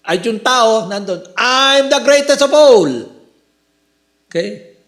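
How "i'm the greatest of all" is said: higher, louder proud boast; boastful claim. Retold or imitated. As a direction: brag it to the world. Mocking a boastful person. (mayabang na pagyayabang, mataas ang boses)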